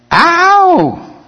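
sharp high yelp of pain. Pretend. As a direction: yelp out loud. Mock pain at crucified flesh.

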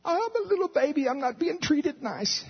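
put-on high, whiny little-baby voice, slowing at the end. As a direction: whimper like a spoiled infant. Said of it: "we're so immature".

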